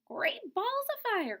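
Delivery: high squawking goose voice; a shocked goose exclamation; high goose cry. Acted. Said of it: "cried the goose".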